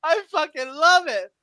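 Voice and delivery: high-pitched cackle and whoop; gleeful shrieking laugh. Real, not acted. Gloating over a rival's downfall, high shrieking laugh and whoop. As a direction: laugh hard, way up high, then cheer.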